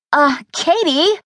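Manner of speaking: high, slow shocked cry of her name; shocked exclaim. A narrator's reading. Maddie reacting to her best friend's confession, high and shocked. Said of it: "I exclaimed".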